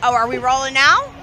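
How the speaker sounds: ordinary delivery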